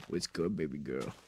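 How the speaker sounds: very deep, slow flirty drawl; soft lazy flirty drawl.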